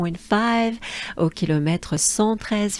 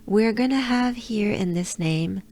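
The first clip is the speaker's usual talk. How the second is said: usual speaking voice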